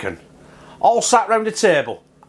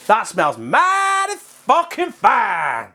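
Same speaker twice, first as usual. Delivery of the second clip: drawn-out, higher-pitched gush of approval; relishing delight; 5 semitones higher, much slower than usual. Real, not acted. Cook smelling his pork from the oven, drawn-out delight. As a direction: stretch the words out with real relish.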